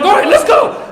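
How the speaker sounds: sudden high, loud shout; acted cop's shouted command; calling the bluff. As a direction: bark it out, ready to go. Cop calls the bluff.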